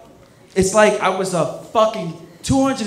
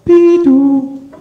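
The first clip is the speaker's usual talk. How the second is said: door chime imitation; 8 semitones higher, 9 dB louder than usual.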